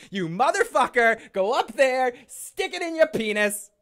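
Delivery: high, loud hammed-up rant; mock furious yell. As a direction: shriek the insult in a cartoon rage. Voicing angry Night King.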